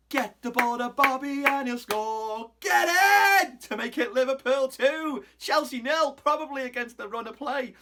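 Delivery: loud, high goal call, slowing down; roaring a Liverpool goal like a TV commentator; goal commentary. Retold or imitated. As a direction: belt it out like a commentator calling a goal. Calling his team's goal like commentary.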